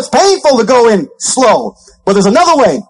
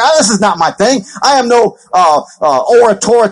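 ordinary talk, nothing marked

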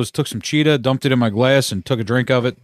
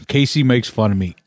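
normal talking voice

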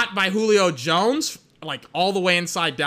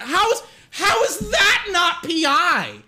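high, slow, loud disbelieving yell; outraged shout; 10 semitones higher, 3 dB louder, slower than usual. Real, not acted. Fan screaming at the officials over a missed call, outraged shout. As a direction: bellow it at the refs. Same complaint shouted again.

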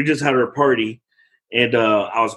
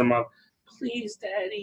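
much softer, higher little plea; pleading toddler; 7 semitones higher, 8 dB quieter, slower than usual. Retold or imitated. Toddler begs Daddy to open her birthday toys, soft and higher. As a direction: beg sweetly and softly, barely voiced. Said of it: "and she said".

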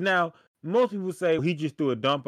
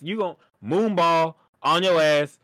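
usual speaking voice

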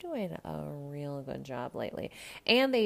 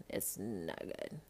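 much lower and softer, slow; low grave repeat; 9 semitones lower, 10 dB quieter, slower than usual. Shown as a demonstration. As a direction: drop it low and slow, disapproving.

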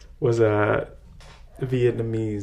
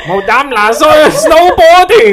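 mock Vietnamese; 13 semitones higher, 12 dB louder than usual. Mock-fluent Vietnamese attempt.

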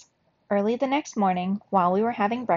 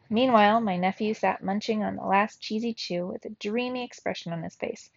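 plain reading voice

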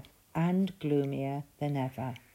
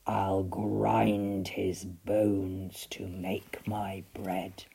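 loud, deep, slow giant voice; booming giant; 5 semitones lower, 7 dB louder, slower than usual. A narrator's reading. Giant sniffing out Jack, loud, deep and slow. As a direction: rumble it like a huge giant.

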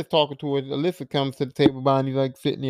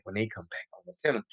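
hushed, lower and much softer; quiet threat; 7 semitones lower, 12 dB quieter, faster than usual. Retold or imitated. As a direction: voice drops right down, softer, low. Bonnie plotting alone in room.

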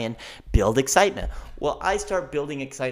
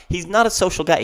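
normal talking voice

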